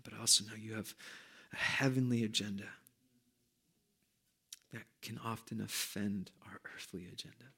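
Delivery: soft prayer dying to a whisper; pray, let it fade to whisper; fading prayer to whisper. Real, not acted.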